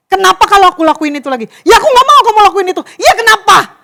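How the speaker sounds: heated shouting quarrel. Acted. Two people shouting at each other in an argument, angry. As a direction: snap back at each other in a rising fight. Acted quarrel, rising voices.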